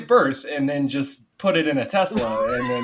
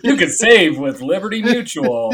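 upbeat commercial read; chirpy insurance ad pitch; 5 semitones higher, 7 dB louder than usual. Retold or imitated. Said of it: "the sounds of you burning alive are just like".

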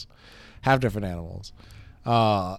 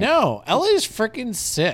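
much higher excited praise; 12 semitones higher, 3 dB louder than usual. Real, not acted. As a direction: gush about it. Enthusing about LA.

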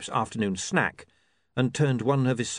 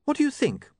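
much higher, anxious question; nervous. A narrator's reading. Saccharissa seeking William's verdict, nervous. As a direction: ask anxiously for approval. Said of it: "she said nervously".

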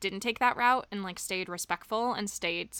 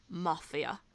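dramatic deadpan; 5 semitones lower, 7 dB quieter than usual. Retold or imitated. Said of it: "that dramatic pause".

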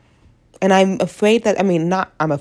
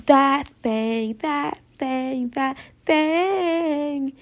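climbing higher on each repeat; mock sing-song; 8 semitones higher, much slower than usual. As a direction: tease it, rising each time. Mocking what men want.

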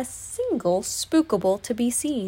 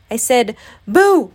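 insistent, slower, loud boo; a bit louder; 2 semitones higher, 9 dB louder than usual. A narrator's reading. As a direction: repeat it firmly, landing loud on "boo". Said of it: "a little bit louder".

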